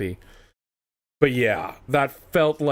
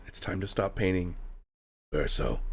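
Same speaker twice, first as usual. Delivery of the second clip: lower, softer, a gentle final word; soft, low farewell; 5 semitones lower, 7 dB quieter than usual. Retold or imitated. The ghost child telling Verso to stop painting, soft and low. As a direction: say it quietly, letting go. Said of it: "and he goes".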